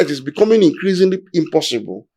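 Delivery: ordinary talk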